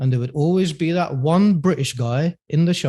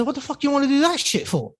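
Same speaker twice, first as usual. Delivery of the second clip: much higher, incredulous Cockney dad; Jason Statham-style Cockney; 11 semitones higher than usual. Retold or imitated. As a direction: imitate a disbelieving Cockney father. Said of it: "He speaks like Jason Statham".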